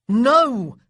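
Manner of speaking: near howl. A narrator's reading. Boy refusing his little sister, practically howling. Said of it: "Daniel practically howled".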